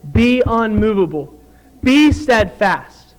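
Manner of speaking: emphatic command, each phrase set apart; firm commandment. Acted. Preacher quoting God's commandment to the congregation, firm and emphatic. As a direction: lay it down as a commandment, no question. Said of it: "this is a commandment".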